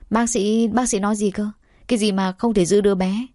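ordinary delivery, nothing marked

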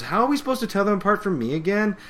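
high, quick retold question; Pinkie questioning. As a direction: imitate a puzzled, chirpy pony. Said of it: "She goes".

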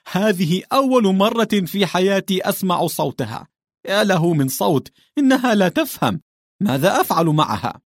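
high, loud, laughing amazement; amused disbelief at her strange voice. A narrator's reading. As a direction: talk through the laughter in disbelief.

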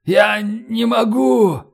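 high, very slow, strained cry; agonised wail of an injured man; wounded, muffled reply. A narrator's reading. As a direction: drag the words out in pain. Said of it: "глухо ответил Роллинсон".

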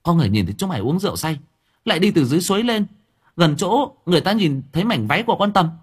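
put-on high, fast, insistent girl's voice; fast accusation. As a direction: rattle off the accusation in a rush. Lán accuses Dũng. (cô gái buộc tội nhanh và dồn dập)

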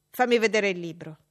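loud, curt order from a bully; spoiled boy demanding the book; bossy command. Acted. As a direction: snap the order at her. John Reed's voice.

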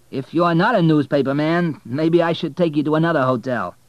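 high, loud, chatty cabbie; cab driver voice. Acted. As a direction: voice the talkative driver.

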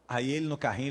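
ordinary talk

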